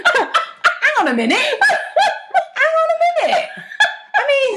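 breaking into high giggles; laughing. Real, not acted. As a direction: laugh through the words. Cracking up at own rant.

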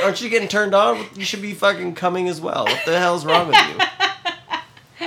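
high-pitched, incredulous partner voice through laughter; mock exasperation. Imagined partner questions her during sex, mock exasperated.

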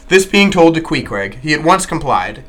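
ordinary delivery

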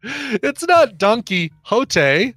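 "Don Quixote" slowly pulled apart into Donkey Hote; high, drawn-out teasing on "Don Quixote"; mock correction.

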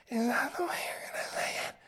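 high whispered ghostly moan. Pretend. A ghost haunting the room, high eerie whisper.

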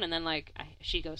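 normal talking voice

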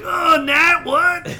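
high, loud, shocked cry; mock shocked shout; stunned yelp. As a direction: yelp it like you just got decked. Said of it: "And he's like".

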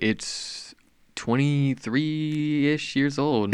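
slow and emphatic. Real, not acted. Drawn-out emphatic reply.

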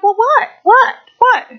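high-pitched clueless "what?"; puzzled, high mock questioning; mock oblivious. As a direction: imitate a mother who notices nothing. Oblivious mother's reply acted out.

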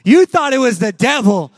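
preaching loud. Real, not acted. Preacher to the congregation: loud, rising. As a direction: hammer the line at the crowd.

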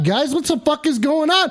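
ordinary talk

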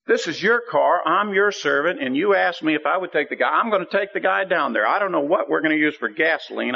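pleading with God, pitched high; impassioned protest. Retold or imitated. Retold argument with God in prayer.

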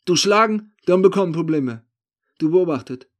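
high, loud broken-German warning. A narrator's reading. Thief warning the narrator against hitting him, warning. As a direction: warn him off sharply. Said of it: "warnt er mich nun".

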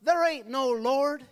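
high, loud shout of an annoyed atheist neighbour; angry shout. Retold or imitated. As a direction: yell it back over the fence, slowly. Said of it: "he would shout".

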